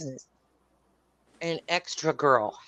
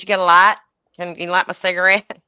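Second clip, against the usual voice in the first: mock pester, voice raised; pestering request; 9 dB louder than usual. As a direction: imitate a pushy woman asking loudly. Acting out the pestering woman's request.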